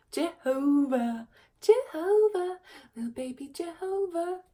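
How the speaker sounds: high, sing-song cooing at a pet. Shown as a demonstration. Owner showing how she coos her dog's name, high baby-talk coo. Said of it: "a funny name to coo".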